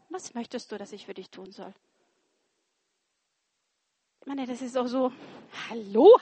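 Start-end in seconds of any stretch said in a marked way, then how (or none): 0.1-1.7 gentle question. Acted. Jesus asks the beggar gently. 5.7-6.2 incredulous hallo. Retold or imitated. Mock disbelief at the obvious.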